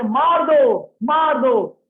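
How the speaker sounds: high, loud cry of kill him, kill him; Bhima's fierce demand, shouted out; shouting for the kill. Acted. Bhima demanding Ashwatthama's death, loud fierce shout.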